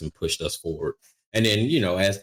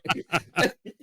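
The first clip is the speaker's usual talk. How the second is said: high laughter; 11 semitones higher than usual. Real, not acted. Still laughing.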